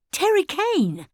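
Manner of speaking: high, startled echo of the name; shocked question. Acted. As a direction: repeat the name in disbelief.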